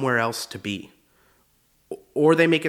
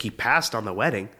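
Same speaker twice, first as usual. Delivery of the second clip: ordinary talk, nothing marked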